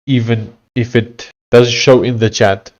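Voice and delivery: ordinary talk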